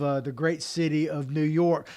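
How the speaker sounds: usual speaking voice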